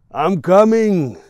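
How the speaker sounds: slow, high, very loud grunting call. Acted. Big Jan calling to the door from bed, loud grunt. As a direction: haul the words out like a groaning giant. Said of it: "he grunts".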